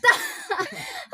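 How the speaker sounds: loud high laugh; burst of laughter. Real, not acted. Caught arguing on camera.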